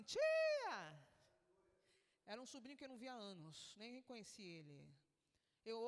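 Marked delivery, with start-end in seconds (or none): 0.0-0.8 high, loud call to an aunt; imitated shout. Nephew yelling to his aunt at the gate, high imitated shout. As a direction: holler it out high, imitating the caller. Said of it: "quem ora lá te grita assim".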